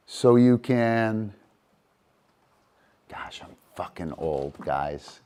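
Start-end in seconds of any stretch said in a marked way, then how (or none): 3.1-5.2 breathy self-mocking whisper; whispered aside. Real, not acted. Trainer muttering to viewers about her age, whispered.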